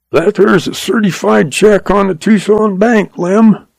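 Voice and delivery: lightly voiced crafty old-timer; old cowboy voice. Hank gloating, higher voice.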